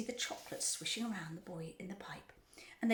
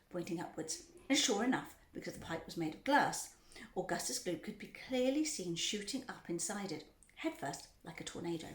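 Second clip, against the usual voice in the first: usual speaking voice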